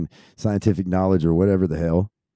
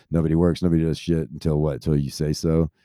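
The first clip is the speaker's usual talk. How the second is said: ordinary delivery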